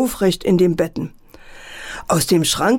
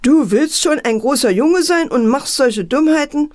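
scolding mother; 8 semitones higher than usual. A narrator's reading. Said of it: "Mutter guckte ärgerlich".

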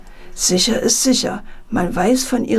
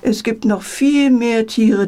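ordinary delivery

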